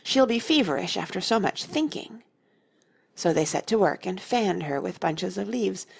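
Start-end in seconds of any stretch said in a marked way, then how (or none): 0.0-2.2 raised, mildly worried voice; understated anxious concern. A narrator's reading. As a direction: fuss with a touch of anxiety. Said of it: "anxiously interrupted".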